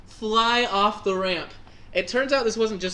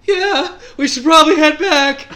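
loud and higher eager echo; eager agreement; 9 semitones higher, 10 dB louder than usual. Retold or imitated. Teller in pain, eagerly echoing friend, loud. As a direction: jump at the chance to go back. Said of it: "I'm like".

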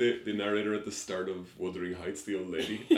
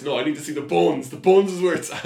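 raised voice building to loud, high demand; mock insistent rant; 7 semitones higher, 11 dB louder than usual. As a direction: get more worked up, for comedy. Bone expert's mock rant.

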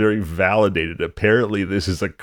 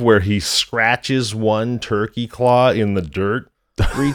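ordinary talk, nothing marked

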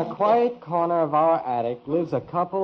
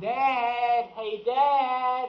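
calling out; 7 semitones higher, 3 dB quieter, much slower than usual. Acted. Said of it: "Don't shout at me".

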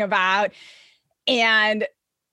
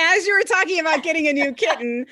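laughing, higher and quicker through the words; 6 semitones higher, 3 dB louder, faster than usual. Real, not acted. Host laughs while telling guest about kitten, laughing. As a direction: let the giggle spill into the line.

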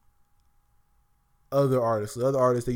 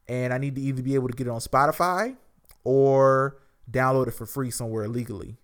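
normal talking voice

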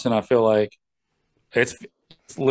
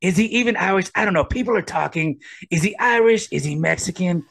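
higher-pitched mock-wondering impression; Trump; 5 semitones higher than usual. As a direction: pile up insinuating questions in a politician's voice. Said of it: "Ah, it's Trump".

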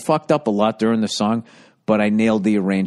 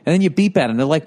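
ordinary delivery

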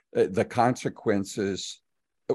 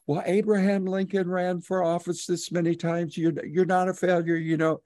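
reassuring son; 8 semitones higher than usual. Retold or imitated. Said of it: "go back and say".

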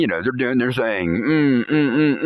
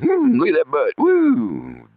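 lustful admiration; 14 semitones higher, 4 dB louder, slower than usual. Retold or imitated. Ogling the woman.

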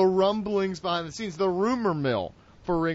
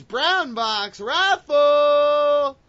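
much higher, louder, every syllable stretched out long; ring announcer call; 7 semitones higher, 6 dB louder, much slower than usual. Acted. Announcing the raffle title.

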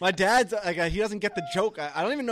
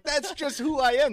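mock defensive; 11 semitones higher, 6 dB louder than usual. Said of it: "I was like".

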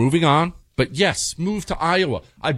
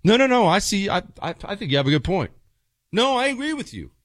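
higher, hurried mock reassurance; eager agreeing husband; 9 semitones higher, faster than usual. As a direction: play the caught-out husband agreeing fast. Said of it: "your answer in that moment always has to be".

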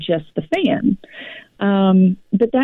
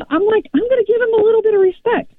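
high rushed aside; 14 semitones higher, much faster than usual. Retold or imitated. Explaining her own caution.

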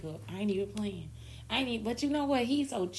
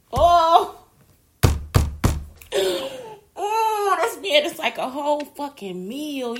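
loud, much higher, crying-out gush; swooning cry; 8 semitones higher, 11 dB louder, slower than usual. Real, not acted. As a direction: cry out like he's too fine. Swooning at his lip.

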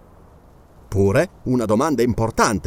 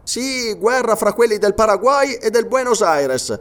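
higher-pitched character voice for a sergeant; sergeant's reply; 6 semitones higher than usual. Acted. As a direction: answer firmly, pitched above the narration. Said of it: "rispose il sergente".

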